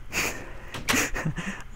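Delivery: soft breathy snicker; quiet guilty chuckle; laugh. Real, not acted. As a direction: laugh under your breath. Laughs at own thieving.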